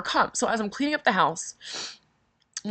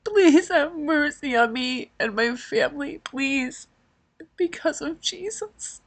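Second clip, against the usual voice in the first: pleading prayer; 6 semitones higher, slower than usual. Retold or imitated. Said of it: "And I just screamed".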